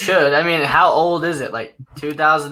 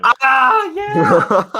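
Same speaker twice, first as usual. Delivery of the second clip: shouted high yeah; excited cheer; 11 semitones higher, 6 dB louder than usual. Real, not acted. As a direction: cheer loudly at the win. Cheering a win.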